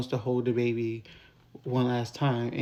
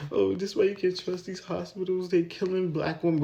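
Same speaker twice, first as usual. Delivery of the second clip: high, put-on distraught voice, lightly done; mock a crying friend's angry lament, pitched up; imitating tearful outrage; 6 semitones higher than usual. Retelling Danny crying at the hospital, high put-on distraught voice. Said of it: "Danny and Sabrina are breaking down, crying".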